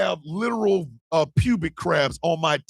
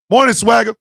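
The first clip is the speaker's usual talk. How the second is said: usual speaking voice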